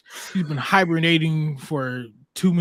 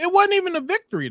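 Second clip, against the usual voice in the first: fast and higher, insistent; emphatic; 12 semitones higher, faster than usual. Real, not acted. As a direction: push the point hard. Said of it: "let's be real".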